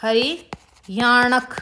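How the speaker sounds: हरियाणक drawn out slowly, syllable by syllable; slow dictation. Shown as a demonstration. Teacher dictating हरियाणक to students, slow and drawn out. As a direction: spell out हरियाणक slowly for the class to write. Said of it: "हरियाणक बोल दिया".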